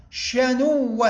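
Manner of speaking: شنوة with a long, doubled w: shanuwwa; doubled waw. Shown as a demonstration. Teacher showing شد الواو, slow geminated pronunciation. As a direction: hold the doubled و in شنوة, slowly. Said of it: "بشد الواو".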